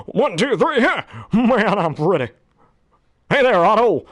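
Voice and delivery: high, swaggering cartoon show-off; Johnny Bravo. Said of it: "Johnny Bravo".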